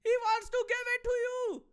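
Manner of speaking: put-on high, loud laughing Punjabi accent; Punjabi auntie voice. Neighbour shouting that Carney is outside, high Punjabi accent laughing. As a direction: cackle through the warning in Punjabi accent. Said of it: "people will learn Punjabi and be like".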